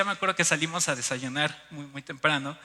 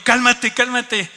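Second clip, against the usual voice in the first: loud, raised mock reprimand; wifely scolding; 5 semitones higher, 9 dB louder, slower than usual. Wife telling shouting husband to calm down, imitated scolding. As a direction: imitate a wife's sharp telling-off. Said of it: "mi esposa me regañó".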